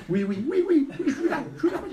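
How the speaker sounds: very high, hurried falsetto replies; flustered secretary. Acted. Secretary answers the phone in a panic, high falsetto.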